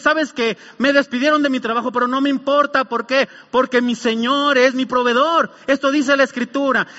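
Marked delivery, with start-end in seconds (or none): 0.0-5.5 loud, higher, emphatic believer's retort; acted confident defiance; defiant declaration of faith. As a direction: preach it as a bold, unshaken answer. Preacher voices defiant believer's declaration.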